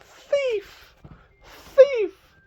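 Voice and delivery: high, loud cries of thief; shouting thief. Acted. Shopkeep runs out yelling after the bandit, high loud shouts. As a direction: scream after the robber. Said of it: "the shopkeep comes running out".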